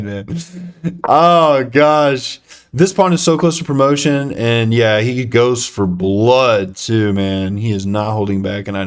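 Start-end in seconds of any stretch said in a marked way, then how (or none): none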